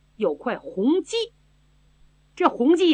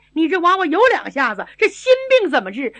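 quick eager praise; 5 semitones higher, 4 dB louder, faster than usual. Acted. Old consort praising the girl.

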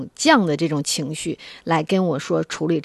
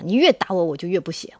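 ordinary talk, nothing marked

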